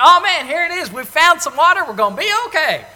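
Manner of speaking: high, loud burst of relief; excited. Retold or imitated. Thirsty Israelites spotting water, loud and excited. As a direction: cry out like thirsty people who just found water. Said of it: "the excitement".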